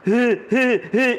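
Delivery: high, imitated "hee hee hee"; mock giggle. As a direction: mimic someone giggling in the background. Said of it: "اس طرح کی آوازیں آ رہی تھی". (نقلی ہی ہی کی ہنسی)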